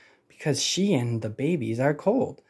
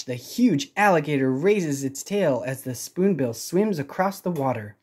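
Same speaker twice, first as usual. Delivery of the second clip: ordinary delivery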